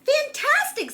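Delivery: high, loud delighted cry; high, loud excited praise. A narrator's reading. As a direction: gush with delight. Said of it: "said Elizabeth".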